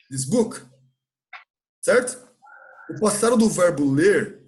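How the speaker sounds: ordinary talk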